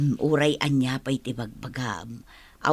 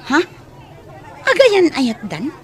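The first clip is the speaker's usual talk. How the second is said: high, loud disbelieving gasp of a question; shocked; 9 semitones higher, 6 dB louder than usual. Acted. Maid reacting to the boss's love news with loud shock. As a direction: blurt it out in shock.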